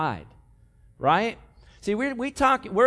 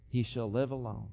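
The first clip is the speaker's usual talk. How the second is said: softer, lower sorrowful reading; sad; 7 semitones lower, 8 dB quieter than usual. Real, not acted. Preacher reading the leper's fate, soft and sorrowful. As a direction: let the grief settle on alone. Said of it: "the saddest words".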